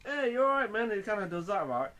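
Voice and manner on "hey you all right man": hammed-up barroom taunt; mock-friendly taunt. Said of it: "and he's like".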